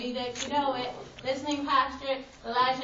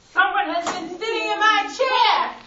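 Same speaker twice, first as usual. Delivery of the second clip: big booming Papa Bear voice; great big voice; 7 semitones higher, 11 dB louder than usual. Acted. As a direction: boom it out like a huge bear. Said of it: "in his great big voice".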